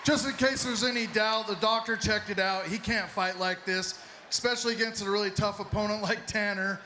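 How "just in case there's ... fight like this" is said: normal talking voice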